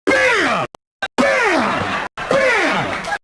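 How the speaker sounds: high punchy bams; imitating hard thrusts; mock impact noises. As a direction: punch each bam out. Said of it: "She's going like".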